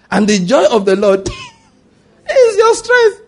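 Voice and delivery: high, loud, laughing shout; joyful proclamation. Real, not acted. Preacher shouting joy over the congregation, laughing.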